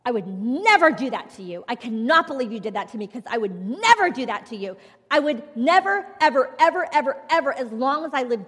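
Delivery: loud, accusing yell at her husband, repeating never; wife yelling at husband over betrayal, furious retold outburst; retold angry outburst. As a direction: shout it at him like the hurt wife she was. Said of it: "that was in an outburst of anger".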